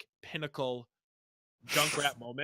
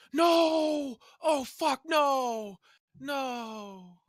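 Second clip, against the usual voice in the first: reenacted anguished screams; 11 semitones higher, 8 dB louder, much slower than usual. Teammate reenacting his panicked comms: screams fading to despair. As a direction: scream no over and over, then let it sink. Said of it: "quick reenactment of our comms".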